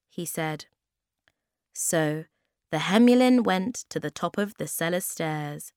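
ordinary delivery

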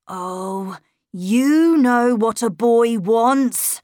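put-on slow, knowing young boy; boy's knowing reply. A narrator's reading. Boy brushes off governess's question, slow and knowing. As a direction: let it drawl out, sure of himself.

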